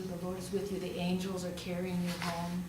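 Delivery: ordinary talk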